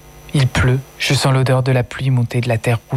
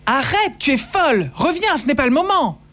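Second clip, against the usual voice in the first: much higher, panicked pleading; mother and sisters cry out at her, much higher and frantic; 12 semitones higher than usual. Acted. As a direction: cry out in alarm to stop her.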